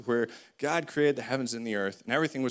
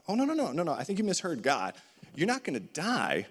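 coaxing snake; 6 semitones higher than usual. Acted. Snake contradicts God.

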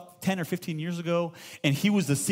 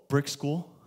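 mock resigned answer; 3 semitones lower than usual. Pastor voices an Israelite kid answering, deadpan. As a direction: answer like a kid who knows no other life.

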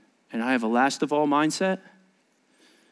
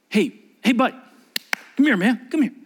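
put-on calling a child over; 5 semitones higher than usual.

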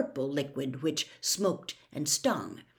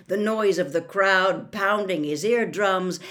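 usual speaking voice

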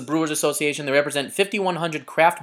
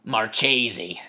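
mock Italian; 6 semitones lower than usual. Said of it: "I'm doing a bit".

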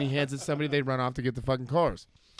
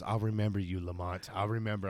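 lower, softer growled threat; low drunk threat; 6 semitones lower, 6 dB quieter than usual. Retold or imitated. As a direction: menace him from deep in the chest. Drunk guy's threat retold again.

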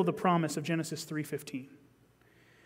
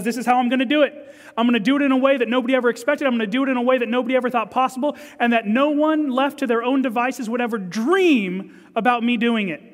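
higher, louder, rushing declaration; building excitement; 5 semitones higher, 5 dB louder, faster than usual. Acted. Preacher voicing God's plan, excited and fast. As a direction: surge forward with bold excitement. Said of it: "God says".

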